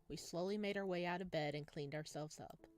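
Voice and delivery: ordinary talk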